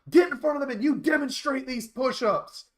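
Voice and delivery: loud, high barked command; angry order. Retold or imitated. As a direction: order him up front. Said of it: "and he goes".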